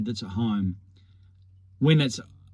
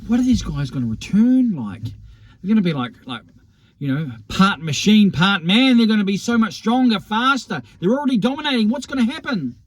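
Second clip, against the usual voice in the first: mock alarm building higher as it goes; imitating worried media; 9 semitones higher than usual. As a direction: play the fretting pundits, voice climbing. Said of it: "the narratives in the media saying".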